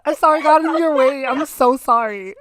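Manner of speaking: high, loud, hammed-up grovelling; mock apologetic. Imitating friend apologizing to the driver who nearly hit her, mock apologetic. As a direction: overdo the apology, slowing on the last sorry. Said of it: "You'd be like".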